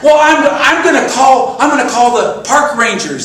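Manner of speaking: high, loud flustered threat; indignant threat. Retold or imitated. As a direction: threaten to call the rangers, worked up. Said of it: "And I said".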